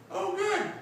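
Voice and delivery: very high, pointed "Oh, good"; sarcastic. Retold or imitated. Father blaming her mother, high sarcastic quip. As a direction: needle it with mock relief. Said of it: "in a desire to blame her mother".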